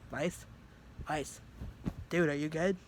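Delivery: calling out. Retold or imitated. Calling out to waking friend.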